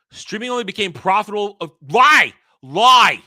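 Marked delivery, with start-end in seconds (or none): 1.9-3.2 loud outraged shout. Real, not acted. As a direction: yell it out. Calling out a lie.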